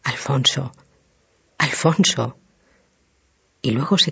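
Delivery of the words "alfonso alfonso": lightly voiced horror, half under the breath; stammered half-voice. A narrator's reading. Widow sees her husband's skull, murmurs in mild horror. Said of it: "tartamudeando a media voz".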